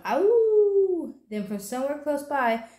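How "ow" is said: loud, high, drawn-out howl; wolf howl. A narrator's reading. Little Bear charges across the meadow, howling loudly. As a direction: let out a long pretend-wolf howl.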